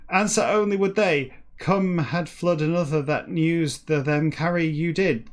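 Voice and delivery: slow, deliberate book reading; declaimed reading. Acted. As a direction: declaim each word of jumbled text with weight. Reads jumbled book text aloud.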